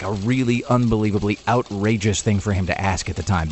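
ordinary talk, nothing marked

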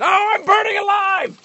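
very high, slow hammed-up shriek; burning scream. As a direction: scream it like you're on fire. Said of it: "this little piggy said".

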